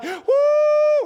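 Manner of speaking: high, loud whoop of joy. Retold or imitated. Two friends speeding across the lake, whooping loud and high. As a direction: let out a thrilled woo. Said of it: "we're like".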